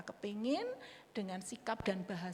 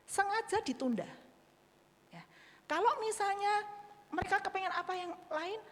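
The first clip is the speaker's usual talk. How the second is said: normal talking voice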